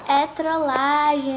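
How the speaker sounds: slow, loud, drawn-out announcement; playful reveal. Retold or imitated. Revealing the prank to the friend, playful and drawn out.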